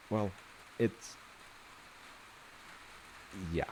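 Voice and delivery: ordinary delivery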